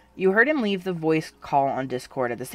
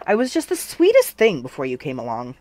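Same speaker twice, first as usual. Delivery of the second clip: mock innocent; 7 dB louder than usual. A narrator's reading. Teasing false innocence.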